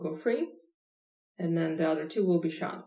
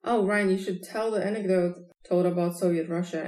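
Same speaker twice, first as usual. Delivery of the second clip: normal talking voice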